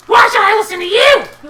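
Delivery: high, loud, shouted goblin defiance; a goblin worker talking back to Little G, shouted high; defiant goblin. Acted. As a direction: sneer back at the boss. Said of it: "He goes".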